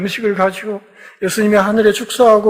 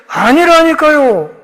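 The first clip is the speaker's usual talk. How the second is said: high, slow and louder: I said no; insisting denial; 8 semitones higher, 5 dB louder, slower than usual. Acted. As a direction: Peter snaps back at the accusers, insisting denial. Peter's heated third denial.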